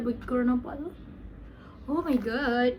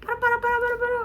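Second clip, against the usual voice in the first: high squealing excitement; shrieking fan excitement; super excited; 14 semitones higher, 5 dB louder than usual. Real, not acted. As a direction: squeal wildly at the first glimpse. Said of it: "Oh my god, I'm super excited".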